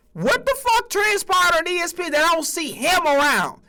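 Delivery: incredulous high-pitched rant; excited disbelief. Real, not acted. Hyping Hodge, asking why gone.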